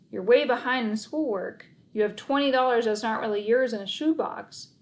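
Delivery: plain reading voice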